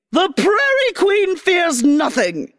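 high, booming, inhuman voice of a grass queen. Acted. Grass queen booming back at the chanting girls. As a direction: boom it across the field like a brassy monster. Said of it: "The voice boomed and echoed".

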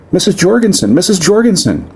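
high, panicked calling out; frantic cry. Acted. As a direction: scream her name in terror. Minnie cries out in panic.

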